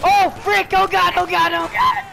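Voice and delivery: rising panicked yell. Real, not acted. Chased by police, panic.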